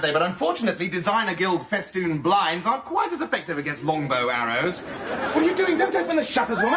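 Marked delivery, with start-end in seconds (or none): 4.8-6.8 high, alarmed shout at his wife; panicked yell. Acted. Alan yelling at his wife not to open the shutters. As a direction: stop her in a high-pitched panic.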